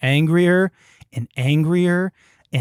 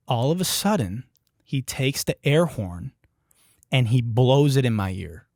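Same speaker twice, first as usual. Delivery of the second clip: usual speaking voice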